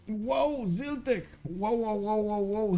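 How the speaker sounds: high, bursting wows of real delight; excited wows. Real, not acted. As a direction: gush over the donation, pitch jumping up. Thanking a donation on stream.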